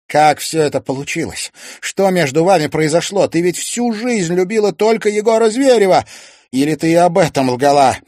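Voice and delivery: loud, high, pressing questions; heated questioning. A narrator's reading. Son questioning mother about his father, heated shouting.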